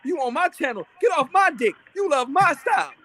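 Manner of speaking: mock angry rant. Imitating a rival rapper telling him off. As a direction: ham up a furious rant. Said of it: "he said".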